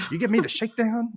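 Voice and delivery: high mock gangster challenge; hammed-up tough-guy question; James Cagney style. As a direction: protest like an old-film crook. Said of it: "he starts getting more like that".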